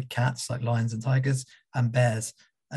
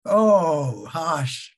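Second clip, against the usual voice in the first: laughing groan; 4 semitones higher, 5 dB louder than usual. Real, not acted. Reacting to the joke.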